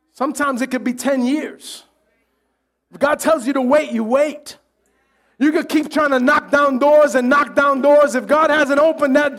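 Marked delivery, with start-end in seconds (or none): none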